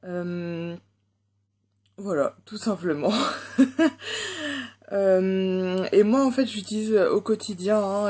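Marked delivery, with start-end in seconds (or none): 3.2-4.9 long, high giggle; high laugh. Real, not acted. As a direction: burst out in a high-pitched laugh. Laughs after wrapping up a point. (long rire aigu)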